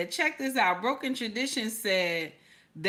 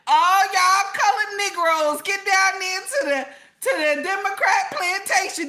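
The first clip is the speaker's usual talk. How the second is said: mock announcement; 7 semitones higher, 4 dB louder than usual. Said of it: "I would have told everybody".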